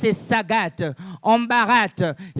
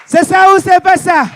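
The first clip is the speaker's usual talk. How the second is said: question criée à la salle; 6 semitones higher, 11 dB louder than usual. Acted. Harangue la salle en criant.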